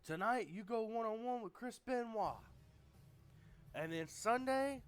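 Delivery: high, loud cocky boss voice; Ric Flair impression. Flair tells Hall, Nash and Steiner who's in, loud and high. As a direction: lord it over them as the president. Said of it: "He says".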